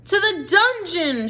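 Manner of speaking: high, slow mob shout; mice shouting. Acted. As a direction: mice shout him to the dungeon. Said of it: "shouted the mice".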